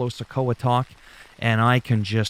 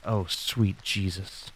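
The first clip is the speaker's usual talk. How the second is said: slow, much softer mock dismay; dismayed groan; 4 semitones lower, 7 dB quieter, slower than usual. First seeing Riddle, slow soft mock despair. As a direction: sigh it out in weary disbelief. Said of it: "I was like".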